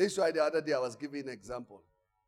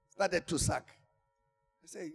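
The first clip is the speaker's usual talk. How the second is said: normal talking voice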